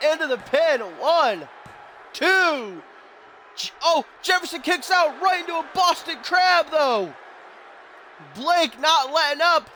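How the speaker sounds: high, shouted play-by-play; excited shouting. Real, not acted. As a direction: scream it like the match hangs on it. Commentator screams through near-fall and submission.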